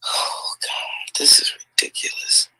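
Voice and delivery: unvoiced, near-crying despair; whimpering whisper. Real, not acted. Vlogger overwhelmed by the news, in a crying whisper. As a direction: whimper it out, almost sobbing.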